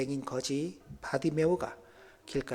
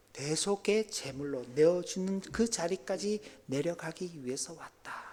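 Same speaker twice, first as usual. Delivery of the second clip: usual speaking voice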